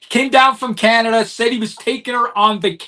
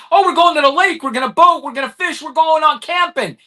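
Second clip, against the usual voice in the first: put-on high, eager child's chatter; bubbling with holiday excitement, pitched up; excited kid; 6 semitones higher than usual. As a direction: act out a thrilled kid rattling off plans. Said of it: "that kid all excited".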